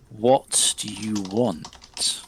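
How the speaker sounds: slow, low, soft otherworldly murmur; honeyed spirit voice. Acted. Spirit questioning the player, slow honeyed murmur. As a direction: drip each word out like honey. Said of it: "says in a voice like honey".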